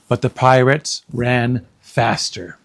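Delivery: ordinary delivery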